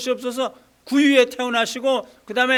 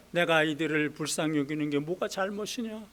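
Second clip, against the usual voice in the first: gentle God; 6 semitones lower, 6 dB quieter than usual. Acted. God answering angry Jonah.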